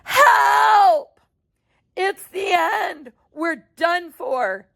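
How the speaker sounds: high, crying yell, slow at the end; terrified shout. Acted. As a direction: panic as the roof smashes. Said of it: "And Grandpa Joe shouted".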